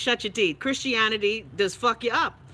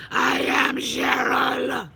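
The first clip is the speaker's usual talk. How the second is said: mock demon voice; 3 semitones higher, much slower than usual. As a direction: drag it out like a possessing demon. Voicing Cheryl as a demon.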